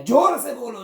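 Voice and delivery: loud, high shouted order; shouted command. Retold or imitated. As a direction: bark it at the old sage. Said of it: "बोल दिया". (ऊँची आवाज़ में डाँटकर)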